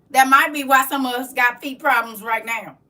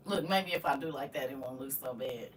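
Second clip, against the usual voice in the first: much softer, lower, under her breath; muttered aside; 13 semitones lower, 16 dB quieter than usual. Real, not acted. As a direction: mutter it to yourself.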